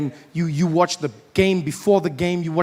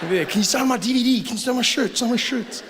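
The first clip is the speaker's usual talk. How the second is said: high excited fan voice; mock fan; 5 semitones higher than usual. Fans gushing over a politician, high mock fan voice. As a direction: gush like a starstruck fan.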